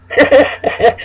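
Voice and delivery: a high, falling giggle. Real, not acted. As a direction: giggle at the Aries' mischief. Laughs about Aries stirring storms.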